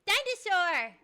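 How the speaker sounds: high, loud gleeful shout; excited shout. Real, not acted. Showing off dinosaur socks with a loud excited yell.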